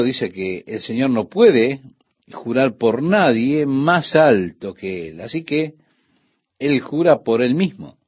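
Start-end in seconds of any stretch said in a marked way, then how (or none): none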